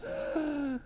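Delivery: high acted child's gasp; shocked gasp. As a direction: gasp in hurt disbelief. Devalued child reacting, acted.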